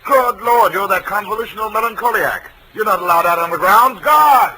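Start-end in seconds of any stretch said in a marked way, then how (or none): 4.0-4.5 ज़ोर से पुकार; calling for guards. Acted. Doctor calls for the guards.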